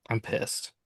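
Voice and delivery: grumpy morning complaint to himself, voiceless; grumpy mutter. Retold or imitated. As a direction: grumble it so nobody hears.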